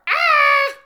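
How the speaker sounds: high, loud scream of "ah"; sudden full-voiced shriek. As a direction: scream the quiz option out. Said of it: "I'm gonna scream".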